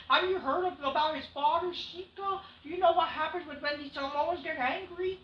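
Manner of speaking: lightly hammed threat; imitating Heyman.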